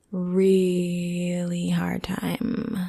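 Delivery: slow hushed whisper; whispered echo. Shown as a demonstration. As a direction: let it fall to a whisper. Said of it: "whispered echo".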